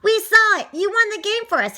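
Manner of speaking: high, loud congratulation; excited cheer. A narrator's reading. Teammates to the boy who won, excited cheer.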